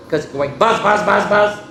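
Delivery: rising buzzy bee sounds; buzzing bees. Acted. Reader imitates the bees for the kids, buzzy. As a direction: buzz like angry bees.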